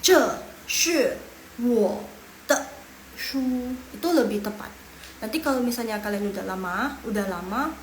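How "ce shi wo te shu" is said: "Ce, shi" loud, with hard falls; tones with gestures. Shown as a demonstration. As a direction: push "Ce, shi" out with your hand. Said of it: "pakai bantuan tangan sama kepala". ("Ce, shi" dengan bantuan tangan)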